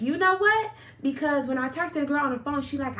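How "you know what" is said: excited realization. Retold or imitated. Retelling her sudden realization.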